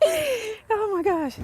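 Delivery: high slow laughing gasp; slow high laugh of relief. Real, not acted. Laughing after the cartwheel.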